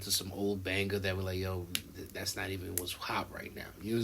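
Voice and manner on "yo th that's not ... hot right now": whispered quote. Retold or imitated. Quoting people abroad, whispered.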